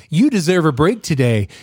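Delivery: slow, put-on ad announcer voice breaking into a laugh; hammed-up somber ad voice, cracking up; mock commercial jingle. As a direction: parody a fast-food ad read, slowly. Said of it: "McDonald's commercials".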